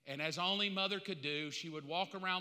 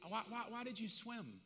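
gentle questioning; 4 semitones higher, 5 dB quieter than usual. Acted. Jesus gently questioning Peter.

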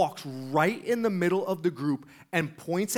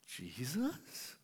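very soft, rising question; intrigued question; 3 semitones lower, 13 dB quieter than usual. Retold or imitated. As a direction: imitate a hushed, tentative listener. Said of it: "He's really listening".

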